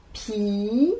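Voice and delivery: long "pi" lifting upward; teacher sounding out "pi"; isolated syllable. Shown as a demonstration. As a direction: hold "pi" out on its own. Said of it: "La première syllabe".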